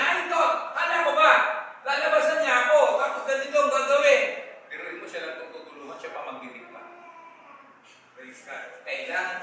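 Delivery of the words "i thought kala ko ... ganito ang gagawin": loud, high indignant outburst of a snubbed general; hammed-up furious complaint; angry shout. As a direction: shout it like an offended man. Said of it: "Galit siya eh".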